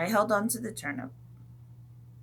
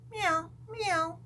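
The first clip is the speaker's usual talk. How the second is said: high, soft meowing; cat meows; 9 semitones higher, 4 dB quieter than usual. Pretend. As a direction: mew like a cat.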